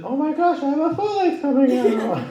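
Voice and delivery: very high, loud gushing; hammed-up, high-pitched thrill; excited squeal. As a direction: imitate a friend bursting with news. Hypes poet's news in excited quote.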